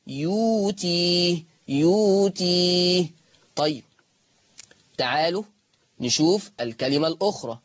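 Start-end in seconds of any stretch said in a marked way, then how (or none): 0.1-3.1 يوتي with a long drawn-out waw, no hamza; Warsh reading yuti. Shown as a demonstration. Teacher models the word for students, slowly drawn out. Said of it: "فقرئت".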